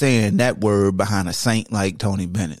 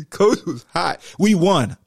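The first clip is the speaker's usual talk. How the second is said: normal talking voice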